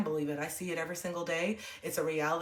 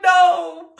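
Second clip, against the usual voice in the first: laugh-crying no; 12 semitones higher, 17 dB louder than usual. Retold or imitated. Her answer in retold scene.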